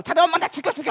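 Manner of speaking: high, petulant mock plea; daughter's tantrum. Daughter coming to her mother to complain, petulant. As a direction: act a fed-up child pitching it up.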